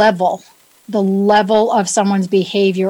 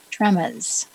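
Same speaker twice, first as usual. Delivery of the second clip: New England accent; 6 dB quieter than usual. Shown as a demonstration. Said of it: "That's my New England accent".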